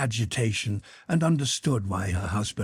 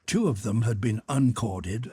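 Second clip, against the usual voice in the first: plain reading voice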